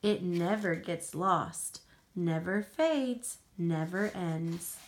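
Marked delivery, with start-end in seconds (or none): none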